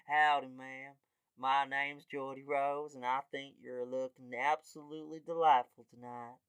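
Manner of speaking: flirt like a mock American cowboy; Geordie Rose. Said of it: "a guy named Geordie Rose".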